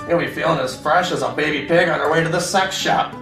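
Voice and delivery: ordinary delivery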